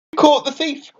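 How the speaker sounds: thrilled at the catch; high excited exclamation; shouted excitedly. A narrator's reading. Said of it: "Franklin shouted excitedly".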